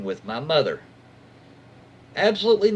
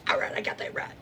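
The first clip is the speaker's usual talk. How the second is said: whispered to self; 8 dB quieter, faster than usual. Real, not acted. Muttering to self after voicing.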